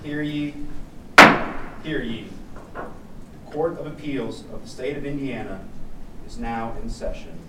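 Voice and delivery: formal call to order; town crier. Real, not acted. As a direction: announce the court like a crier. Bailiff opens court session.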